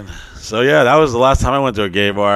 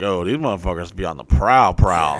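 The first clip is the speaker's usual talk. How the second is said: low predator voice; 6 semitones lower, 3 dB quieter than usual. Retold or imitated. Mocking guys on the prowl.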